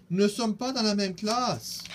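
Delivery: high, loud dismayed cry; two boys crying out in dismay; cried out together. A narrator's reading. Boys discovering they are split up, crying out loud. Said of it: "se regardent et s'écrient".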